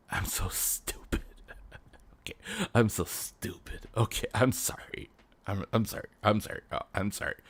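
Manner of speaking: mock embarrassed hush; sheepish whisper. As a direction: mumble sorry like a caught kid. Embarrassed apology in the skit.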